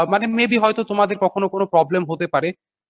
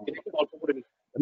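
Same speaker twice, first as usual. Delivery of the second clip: quiet repeated aside; trailing off softly; 5 semitones lower, 12 dB quieter than usual. Shown as a demonstration. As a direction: drop to a murmur for the repeat. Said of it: "repeats the phrase, trailing off". (নিচু গলায় ধীরে মিলিয়ে যাওয়া)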